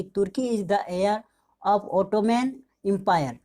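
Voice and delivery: "Turki is the heir" read out slowly, word by word; slow, careful dictation of an example sentence. Shown as a demonstration. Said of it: "कुछ sentence लिया है".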